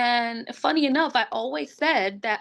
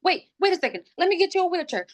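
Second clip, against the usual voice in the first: higher, flustered rush of words; retold panic, pitched up; mock freak-out; 7 semitones higher, faster than usual. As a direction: imitate the panicking nurse. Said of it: "he started freaking out".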